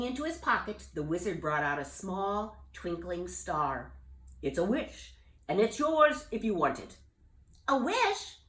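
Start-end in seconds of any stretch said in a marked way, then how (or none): 4.4-7.0 lightly voiced old wizard, kindly; a hint of a wise old wizard offering a gift. Wizard offering Jubal a wish, lightly voiced. 7.7-8.4 high, surprised bullfrog cry; exclaiming. Acted. Jubal amazed at the wizard's gift, exclaiming high. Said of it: "Jubal exclaimed".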